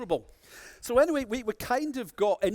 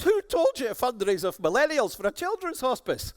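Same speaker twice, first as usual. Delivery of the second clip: higher, louder disbelieving question; indignant challenge; 6 semitones higher, 4 dB louder than usual. Retold or imitated. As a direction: demand to know who came up with such nonsense.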